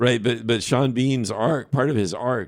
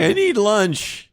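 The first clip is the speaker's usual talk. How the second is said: whiny actor voice; 10 semitones higher, much slower than usual. Retold or imitated. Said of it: "He's like".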